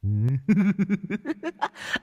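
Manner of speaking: high, far-off shrill cackle of a ghost; shrieking ghost laugh. A narrator's reading. Ghost shrieks with laughter at the exorcist. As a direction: shriek with eerie glee. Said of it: "cười ré lên".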